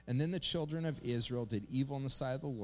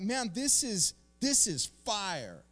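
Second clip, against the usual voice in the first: thrilled; 8 semitones higher, 4 dB louder, slower than usual. Retold or imitated. Imitating a thrilled believer.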